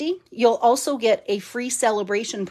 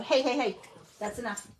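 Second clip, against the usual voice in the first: scolding the dogs; 3 semitones higher, 7 dB quieter than usual. Real, not acted. Crafter scolding her dogs mid-video, quiet and firm.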